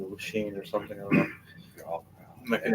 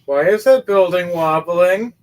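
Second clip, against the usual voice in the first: higher, louder, slow alarmed call; alarmed question; 9 semitones higher, 9 dB louder, slower than usual. Acted. Girl asking the party why the building wobbles, loud and alarmed. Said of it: "She looks over going".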